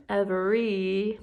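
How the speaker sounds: EVERY slow, its final Y held as ee; long E sound. Shown as a demonstration. Teacher showing what the Y says, long E sound. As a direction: stretch the end of EVERY into a long ee. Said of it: "makes the long E sound".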